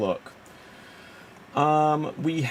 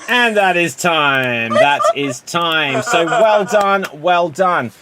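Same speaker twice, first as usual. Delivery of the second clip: loud, raised host call ending the round; calling time; 4 semitones higher, 8 dB louder, slower than usual. Real, not acted. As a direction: project over the players to stop the scene. Host calls time over scene.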